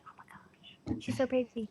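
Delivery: breathless whisper of admiration; hushed gushing whisper; awed whisper. Real, not acted. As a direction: whisper it, starstruck. Gushing over the actress.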